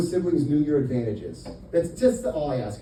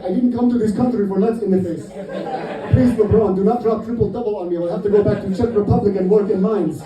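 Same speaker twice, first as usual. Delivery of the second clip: put-on Eastern European accent; 4 semitones higher, 6 dB louder than usual. Eastern European player begging.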